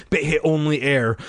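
usual speaking voice

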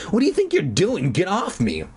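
half-yelled sibling objection; screamed protest. A narrator's reading. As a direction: rush it out in mild acted outrage. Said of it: "he screamed".

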